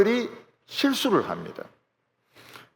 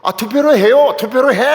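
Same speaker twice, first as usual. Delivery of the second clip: loud, raised Seoul-dialect demand; imitated Seoul argument, pitched up and forceful; Seoul-accent quarrel; 5 semitones higher, 7 dB louder than usual. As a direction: mimic Seoul churchgoers arguing, loud and insistent. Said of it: "서울 사람들 싸우는 거".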